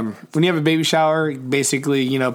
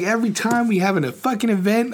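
retold exasperation; 8 semitones higher than usual. Re-enacting quarrel with partner.

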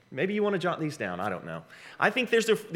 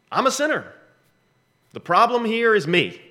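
exclaimed confession; 8 semitones higher, 4 dB louder than usual. Shown as a demonstration. Voicing confession high.

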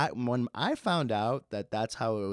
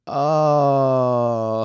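embarrassed realization; 3 semitones higher, 10 dB louder than usual. Real, not acted. Realizing his mistake.

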